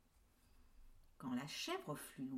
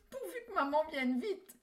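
put-on frightened kids; 11 semitones higher, 7 dB louder than usual. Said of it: "tremblent de peur".

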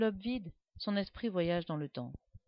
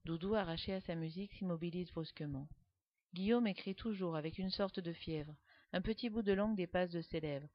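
plain reading voice

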